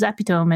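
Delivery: "epitome" as EP-i-tome; three-syllable "epitome", silent final e; misread epitome. Retold or imitated. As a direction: say "epitome" to rhyme with home. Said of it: "I was like".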